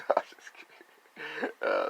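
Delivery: ordinary delivery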